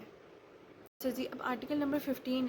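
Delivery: usual speaking voice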